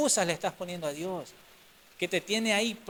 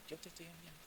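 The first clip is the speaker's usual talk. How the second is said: soft divine insistence; 22 dB quieter, faster than usual. Acted. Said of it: "el Señor diciéndole".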